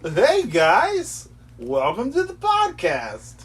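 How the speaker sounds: high, loud, drawn-out podcast greeting; hyped podcast host. Shown as a demonstration. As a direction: greet listeners like an over-eager host. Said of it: "What's your podcast voice?".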